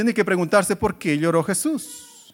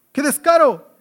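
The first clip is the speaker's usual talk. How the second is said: indignant exclamation; 7 semitones higher, 6 dB louder than usual. Real, not acted. Preacher outraged at Esaú's request.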